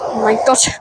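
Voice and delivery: usual speaking voice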